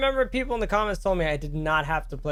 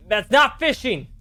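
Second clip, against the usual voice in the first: exasperated shout; 7 semitones higher, 8 dB louder than usual. Real, not acted. Mocking the game character's fishing.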